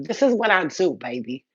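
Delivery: ordinary talk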